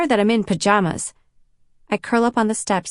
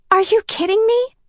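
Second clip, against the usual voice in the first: high, loud disbelief; stunned and furious; shocked outrage; 13 semitones higher, 3 dB louder than usual. Acted. Cassie outraged at betrayal.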